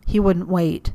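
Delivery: ordinary delivery, nothing marked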